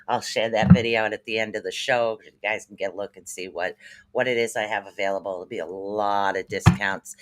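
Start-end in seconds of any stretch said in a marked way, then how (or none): none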